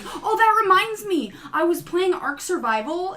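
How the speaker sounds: slow, high mock segue; mocking own tangents. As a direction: play yourself veering happily off topic. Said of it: "I'm like".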